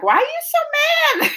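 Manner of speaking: high-pitched teasing question; joking. Retold or imitated. Joker asks the people they yelled at, playful teasing. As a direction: tease with a mock-puzzled, high upswing. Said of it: "I'm laughing and joking".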